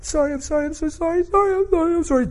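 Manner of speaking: high, squealed mock sobbing; hammed-up tearful wail; imitated uncontrollable crying. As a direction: imitate someone blubbering apologies in a very high voice. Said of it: "She's crying uncontrollably".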